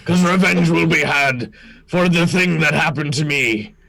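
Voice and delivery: declamatory captain, dragging out the final words; vengeful orc pirate captain. Acted. Pirate captain names his ship, vengeful theatrical declaration. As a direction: proclaim the grudge, then slow and drop at the end.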